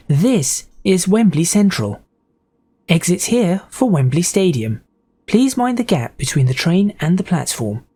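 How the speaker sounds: measured, evenly spaced station announcement; train announcer. Acted. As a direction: announce the stop word by word. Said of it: "I could be the enunciator".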